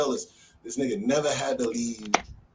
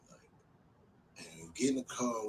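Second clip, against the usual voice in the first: normal talking voice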